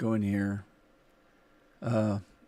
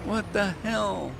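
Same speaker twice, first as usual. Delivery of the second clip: baffled; 9 semitones higher, slower than usual. Real, not acted. Realises wrong crop loaded.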